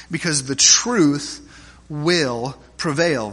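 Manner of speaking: each word stretched and weighed, truth and prevail leaned on; slow emphatic repeat. Acted. Preacher pressing the point home to the congregation, slow and weighty. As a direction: land it slowly: Because the truth will prevail.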